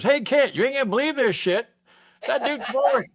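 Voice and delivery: high, loud gleeful shout to a buddy; whooping gleeful callout; excited call out. Retold or imitated. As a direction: yell the gossip across the yard with glee. Friend gleefully calling out news.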